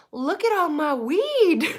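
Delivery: put-on high, slow, proud little-kid voice; child voice. Mom imitating daughter proudly showing her field, high and slow. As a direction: say it like a proud toddler. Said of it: "she goes".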